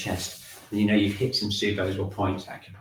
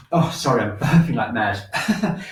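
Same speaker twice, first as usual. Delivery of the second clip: real chuckle breaking into the words; laughing apology; 4 dB louder, slower than usual. Real, not acted. Teacher excusing burps to the class, laughing. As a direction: laugh through an admission, slightly louder. Embarrassed by own burping fit.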